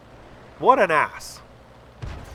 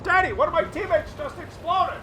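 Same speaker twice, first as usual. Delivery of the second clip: put-on high little boy calling daddy; child voice; 13 semitones higher than usual. Commentator voicing a child to his dad, high child voice. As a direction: call out like a startled little kid.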